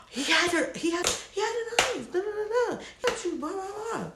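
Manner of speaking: high, frantic mock panic; panicked. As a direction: rattle it off in a high frantic rush. Panicked friends quoted.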